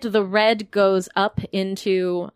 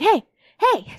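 character voice; 11 semitones higher, 4 dB louder than usual. Acted. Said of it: "Emmy's like".

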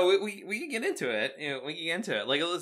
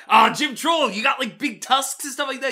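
higher, louder teasing shout; mocking crowd yell; 9 semitones higher, 7 dB louder than usual. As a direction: jeer at the wrestler like a crowd. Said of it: "Everyone's like".

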